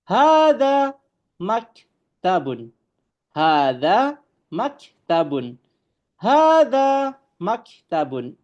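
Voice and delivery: slow, high, careful Arabic recitation of "Hadza babun"; Arabic model reading. Shown as a demonstration. Teacher reading Arabic to students, slow and emphatic. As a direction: drawl out "Hadza babun" for the class to copy. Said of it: "Ini adalah sebuah meja".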